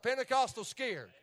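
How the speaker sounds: ordinary talk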